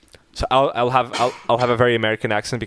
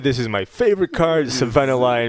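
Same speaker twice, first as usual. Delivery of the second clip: hammed-up American accent, higher; 3 semitones higher, 4 dB louder than usual. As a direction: brag like an American card player, loud. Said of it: "I did say beta because they were American".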